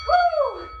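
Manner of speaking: loud high whoop of effort; excited whoop. Real, not acted. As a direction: let out a big cheering woo. Coach cheers mid-exercise.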